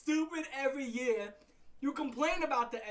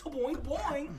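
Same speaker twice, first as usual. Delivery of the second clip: springy double boing; bouncy springy boingy voice; 3 semitones higher, 4 dB louder than usual. Retold or imitated.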